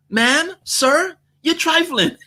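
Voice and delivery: high, playful scolding; teasing rebuke. Shown as a demonstration. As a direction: call him out with a grin. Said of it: "You can say".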